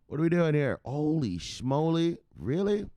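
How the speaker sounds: hammed-up shock; mock amazement. Real, not acted. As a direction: draw out the disbelief then squeak.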